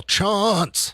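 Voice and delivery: very high drawn-out call of the name. Retold or imitated. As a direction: call out from the next room, stretched. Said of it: "He's all".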